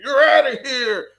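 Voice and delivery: hammed-up high, loud umpire call, mock cry; mock the umpire's big ejection; playing the umpire tossing him, hammed-up and loud; over-the-top umpire. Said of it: "this over-the-top reaction".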